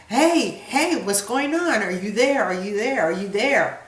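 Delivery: high, loud, frantic calling into the phone; a father yelling to check she's still there; retold frantic screaming. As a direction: shout down the line like someone panicking. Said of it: "he was screaming at me".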